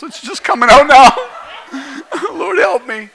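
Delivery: cracking up at oneself; real laughter. Real, not acted. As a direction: laugh helplessly through the words. Breaks up over his gerbil story.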